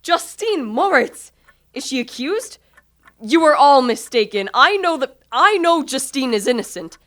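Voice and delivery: loud, high insistent protest; indignant protest. Acted. Victor protesting to Ernest that Justine is innocent, indignant. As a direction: defend her fiercely.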